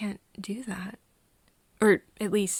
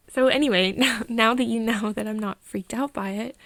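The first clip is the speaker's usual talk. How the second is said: louder, brighten up through a laugh; flustered laughing; 2 semitones higher, 8 dB louder than usual. Acted. Reassuring a friend they're not freaked out, laughing. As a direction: laugh it off and move on loudly.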